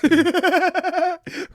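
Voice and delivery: high, loud burst of real laughter. Real, not acted. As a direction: crack up hard.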